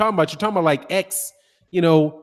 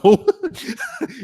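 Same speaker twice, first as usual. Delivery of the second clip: a sudden high laugh that breaks out and falls away; high burst of laughter. Real, not acted. Laughing as the kid explains hit a lick.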